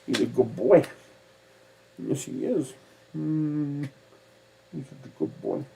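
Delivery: high, soft praise for a pet; talking to a dog. Real, not acted.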